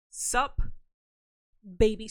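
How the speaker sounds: flirty. Pretend. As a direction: sell the cheesy line.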